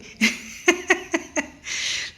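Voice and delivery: high, breathy giggle; sustained, airy laughter; real laugh. Real, not acted. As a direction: giggle at your own daydream. Laughs imagining making a splash.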